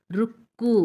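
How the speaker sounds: రుక్కు with a firm doubled k; pressed double consonant. Shown as a demonstration. Teacher reads a word for children, doubled k pressed. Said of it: "కూని ఒత్తి పెట్టి పలకాలి".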